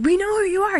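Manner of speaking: high-pitched mock demon voice; demon taunt. Demons taunting Jesus, acted.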